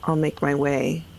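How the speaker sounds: ordinary talk, nothing marked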